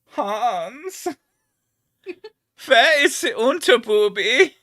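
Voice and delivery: high, exaggerated German accent. Shown as a demonstration. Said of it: "He has two German accents".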